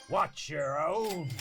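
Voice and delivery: slow mock growl. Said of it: "she growls".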